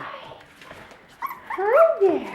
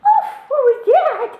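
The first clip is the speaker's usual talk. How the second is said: startled. Real, not acted. Sudden surprise.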